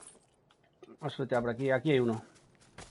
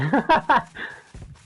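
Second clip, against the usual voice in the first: high laugh; 10 semitones higher, 6 dB louder than usual. Real, not acted. Laughing after kill.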